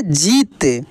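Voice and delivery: जीत with a long, stretched ī, pitch falling low; drawn-out long ī. Shown as a demonstration. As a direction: stretch the ī in जीत as a teacher demonstrating. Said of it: "इसका उच्चारण खींच कर होता है". (जीत की ई खींच कर)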